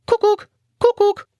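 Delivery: very high bird call; cuckoo call. A narrator's reading. Cuckoo bird swooping in to help, a high call.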